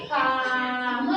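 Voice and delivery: drawn-out syllables glided together without a break; stretched blend. Shown as a demonstration. Teacher to young pupils, modelling the blend slowly. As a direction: slide slowly from क to म without stopping. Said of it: "अपनी गाड़ी से बिना रुके पढ़ूंगी".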